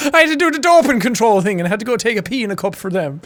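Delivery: imitated broad Irish rower; exaggerated Irish retelling; Irish accent. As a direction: parrot it with a thick Irish lilt. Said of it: "this is one of those accents".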